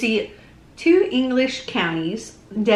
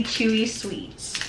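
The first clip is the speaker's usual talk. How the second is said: ordinary talk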